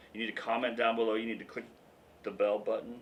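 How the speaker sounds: ordinary talk, nothing marked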